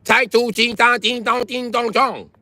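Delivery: very high, sing-song caricature. Retold or imitated. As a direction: imitate a squeaky foreign-language greeting. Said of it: "Them bitches is all TikTok like".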